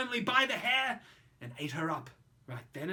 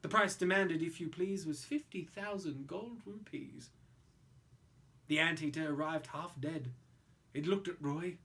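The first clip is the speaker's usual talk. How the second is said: usual speaking voice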